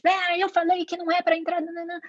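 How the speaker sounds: high-pitched impatient parent telling off; mock scolding. Parent reacting without thinking to a child, mock scolding. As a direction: act out a parent's knee-jerk telling-off. Reação impulsiva de mãe, encenada.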